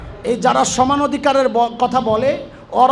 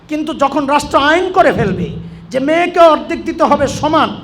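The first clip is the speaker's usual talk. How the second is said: preaching at full force, higher and louder, building to shouts; fiery preaching; 3 semitones higher, 5 dB louder than usual. Acted. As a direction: hammer the warning home to the crowd.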